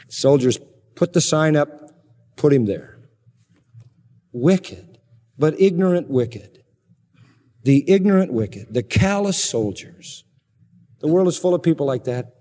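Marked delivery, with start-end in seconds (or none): none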